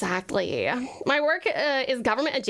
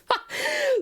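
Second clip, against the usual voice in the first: sudden high laugh; high burst of laughter. Real, not acted. Laughs at own Valley Girl voice.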